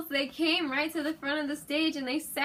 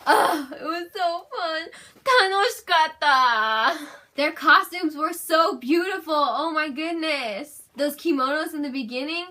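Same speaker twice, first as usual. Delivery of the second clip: teary excitement; 5 semitones higher, 8 dB louder than usual. Real, not acted. Emotional recalling concert.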